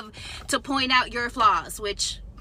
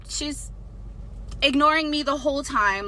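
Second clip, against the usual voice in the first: usual speaking voice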